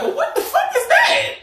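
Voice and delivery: high, loud disbelieving squeal; retelling a shocked reaction to a karma text; shocked disbelief. Retold or imitated. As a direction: shriek it in total disbelief. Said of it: "I'm like".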